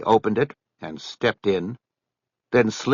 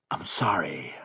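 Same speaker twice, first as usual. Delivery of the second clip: soft guilty whisper under the breath; whispered apology; 3 semitones lower, 7 dB quieter than usual. Acted. Buddy confesses, hiding his face.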